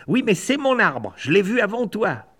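high, stubborn squabble; rival blackbird arguing. Acted. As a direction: argue the claim in a high voice.

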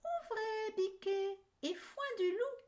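very high sweet fake voice; wolf faking mother. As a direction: squeak it like the mother.

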